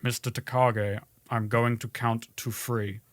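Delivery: a menacing German-tinged villain counting down; lightly hammy Alan Rickman villain; Hans Gruber impression. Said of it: "this is gonna be bad".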